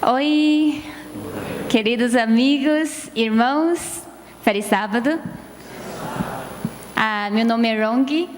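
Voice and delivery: ordinary delivery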